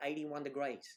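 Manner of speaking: mock Australian weather readout; Australian accent. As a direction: imitate an Australian voice assistant. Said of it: "her accent is Australian".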